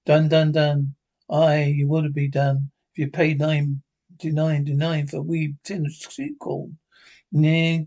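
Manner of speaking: ordinary talk, nothing marked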